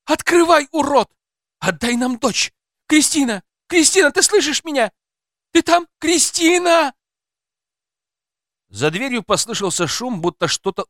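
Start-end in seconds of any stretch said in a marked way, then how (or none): 0.0-6.9 very high, loud shouting through a door; desperate screaming. Acted. Mother calling to her daughter behind the door, desperate screaming. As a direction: scream out for your child.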